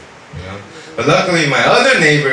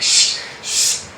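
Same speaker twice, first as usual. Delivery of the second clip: whispered shh shh of a stabbing knife; imitated knife slashes. Telling how he'd have used a knife, whispered slashing sounds. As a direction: mime two quick knife stabs with breath. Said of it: "I would've went, like".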